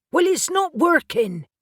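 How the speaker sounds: exasperated yell as Neil lunges. A narrator's reading. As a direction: shout it over the fight. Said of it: "Reuben yelled".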